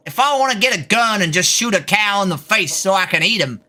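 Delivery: hammed-up Texas accent, lightly done; a Texan playing it up, drawling the vowels. Streamer performs a Texas accent for chat, hammed-up. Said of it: "that's my right as a Texan".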